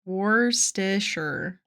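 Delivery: "Worcestershire" drawn out slowly as "WUR-stih-sher"; slow sounding-out. Shown as a demonstration. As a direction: sound out "Worcestershire" in three stretched syllables. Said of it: "That feels so simple".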